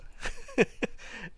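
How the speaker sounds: breathy high laugh burst; quick unvoiced chuckle; short laugh. Real, not acted. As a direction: laugh it off, airy. Laughing at the boss's weirdness.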